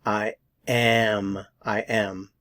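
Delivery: usual speaking voice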